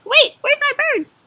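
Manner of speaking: high alarmed mother bird voice; a worried mom searching her nest; mama bird. Retold or imitated. Mother bird panics finding her babies gone, high alarmed voice. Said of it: "Mama's like".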